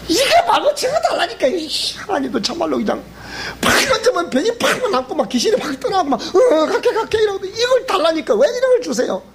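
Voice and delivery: high, laughing petulant demand; laughing mock whine. Believer whining at God for other gifts, laughing. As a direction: whine like a spoiled child while laughing.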